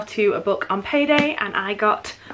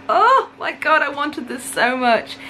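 high, loud burst easing into a drawn-out gush; real excitement, pitched up at the start; excited; 6 semitones higher, 3 dB louder than usual. Real, not acted. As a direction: gush over a long-wanted treat. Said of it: "I'm so excited about this".

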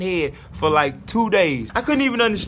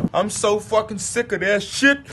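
normal talking voice

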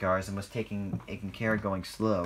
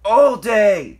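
complaint building to a loud, high bark; grumble, then explode on the repeat; fed-up rant rising to a shout; angry growl; 3 semitones higher, 11 dB louder than usual. A narrator's reading. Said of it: "growled Airy".

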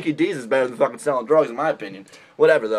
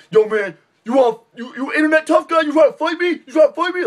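much higher, hammed-up tough-guy taunt; mock tough guy; 11 semitones higher, 4 dB louder than usual. Mocking a tough guy picking fights, high taunt. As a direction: taunt like a puffed-up internet thug. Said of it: "They're like".

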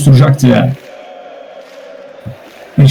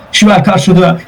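ordinary talk, nothing marked

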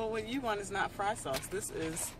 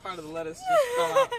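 high, laughing through the words; 6 dB louder than usual. Real, not acted. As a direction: giggle it out, squeaky. Lettuce falls, laughing.

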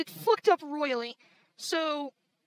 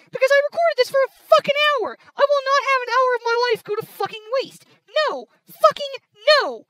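raised, loud angry insistence; indignant rant, high and forceful; real indignation; 5 semitones higher, 5 dB louder than usual. Real, not acted. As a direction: insist hotly, hammering out the grievance. Refuses to waste hour of work.

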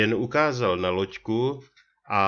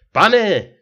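desperate cry, much higher and louder; anguished cry; 11 semitones higher, 10 dB louder than usual. A narrator's reading. Ferryman cries out as the stranger leaps into the river, much higher and louder. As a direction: call after the man who just jumped, anguished. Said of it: "zasténal převozník".

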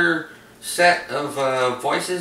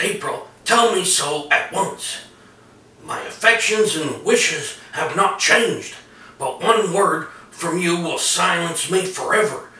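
Solomon Grundy; 4 semitones higher than usual. Retold or imitated. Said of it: "like a raspy voice with a southern accent".

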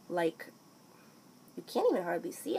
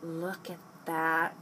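ordinary talk

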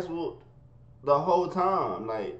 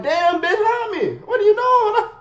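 high, loud pleading cry for help; desperate plea; 9 dB louder, slower than usual. Retold or imitated. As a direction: beg for help while laughing. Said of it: "I'm like".

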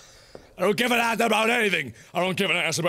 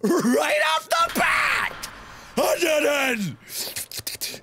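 high, slow, stretched cry of victory; triumphant squeal; 9 semitones higher, much slower than usual. Real, not acted. Gamer finally clears the part, a high triumphant squeal. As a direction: crow over the win.

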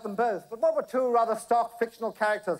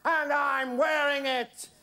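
high, slow crowing boast; triumphant roar; 7 semitones higher, much slower than usual. A narrator's reading. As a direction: draw it out in triumph. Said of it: "roared Ginger in triumph".

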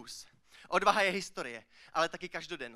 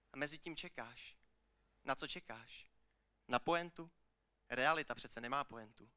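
low, soft spoken-word delivery; hushed poem ending; 5 semitones lower, 8 dB quieter than usual. Acted. Poet ends the poem on a missing punchline, hushed and low. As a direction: drop right down, leaving pauses between the lines.

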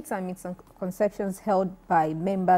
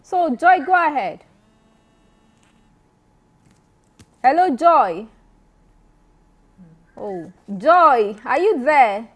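shouting into a phone; 2 semitones higher, 7 dB louder than usual. Real, not acted. Host calling to caller, bad line.

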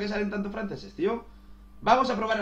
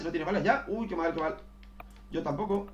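ordinary delivery

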